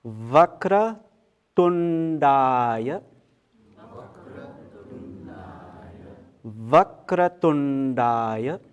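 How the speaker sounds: intoned Sanskrit, long vowels stretched out; word-by-word mantra teaching. Shown as a demonstration. Teacher leading students through the mantra, word-by-word recitation. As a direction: recite each word deliberately for others to echo. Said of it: "one word at a time".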